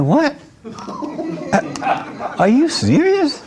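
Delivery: high, startled "what, are you serious"; shocked groom. Retold or imitated. Panicked groom voice. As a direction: groom at the altar hearing he's making a mistake, high alarmed disbelief. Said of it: "He's like".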